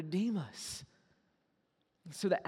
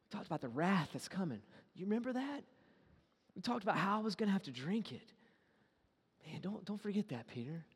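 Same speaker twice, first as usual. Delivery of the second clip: soft, quiet, tender plea; Jesus gently reminding Peter; gentle reminder; 7 dB quieter, faster than usual. Retold or imitated. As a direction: lean in and remind him softly. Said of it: "He's like".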